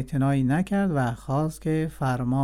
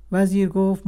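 ordinary delivery

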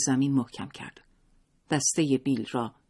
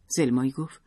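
ordinary delivery, nothing marked

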